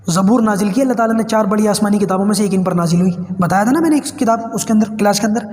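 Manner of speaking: usual speaking voice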